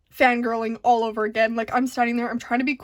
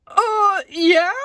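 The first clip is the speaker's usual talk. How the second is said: startled; 6 semitones higher, 6 dB louder than usual. Retold or imitated. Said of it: "And I'm like".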